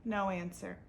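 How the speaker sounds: plain reading voice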